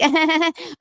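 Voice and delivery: high-pitched put-on laugh; mock laugh. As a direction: laugh it off as an imitation. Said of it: "And Christine's like".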